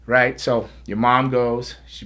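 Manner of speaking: ordinary delivery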